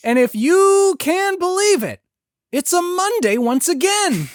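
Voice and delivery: hammy shouted weather report; David Lynch. Acted. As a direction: holler the date phrase by phrase, stretched out. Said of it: "why doesn't he do the weather report anymore?".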